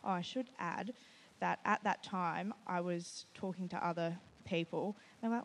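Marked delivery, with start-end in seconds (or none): none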